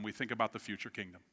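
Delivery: usual speaking voice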